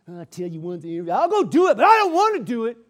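obey under loud protest; grudging compliance. Acted. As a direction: act out a reluctant, complaining helper. Acting out a grudging, obedient person.

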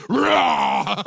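loud imitated bear roar. Bear turns on the girl, loud mock roar. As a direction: roar suddenly like a comic bear. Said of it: "the bear turns around and goes".

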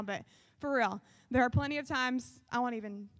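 ordinary delivery

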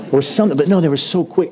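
normal talking voice

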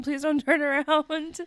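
the plea peaks as tension hits its height; rising panicked plea. Retold or imitated. Repeated plea, rising to climax.

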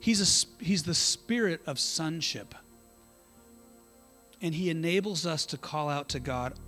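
usual speaking voice

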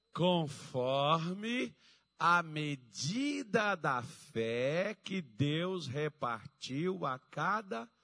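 loud, very slow, drawn-out verse reading; preaching. Acted. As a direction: proclaim the verse to the congregation, stretching each phrase. Preacher reads verse at full force.

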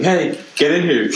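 mock call from a car window. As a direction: call her over, playfully.

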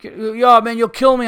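loud, high pushy carnie whine; mocking carnie. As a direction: mimic the nagging hustler. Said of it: "Guy was pissing me off".

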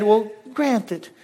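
a mother asking favors, lightly Southern; Southern accent. Retold or imitated. Mother asking Jesus for her sons, a light Southern voice. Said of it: "she's Southern, I'm sorry".

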